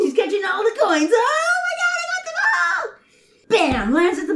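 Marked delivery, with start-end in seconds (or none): 1.1-2.8 excited shouting. Acted. Coins excitement.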